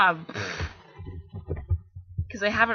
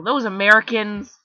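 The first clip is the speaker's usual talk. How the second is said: light put-on American accent, louder; mock American accent; 8 dB louder than usual. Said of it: "I'm talking like an American".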